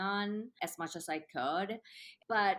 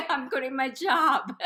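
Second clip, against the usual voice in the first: laughing; 8 semitones higher, 8 dB louder than usual. Real, not acted. Laughing while retelling decision.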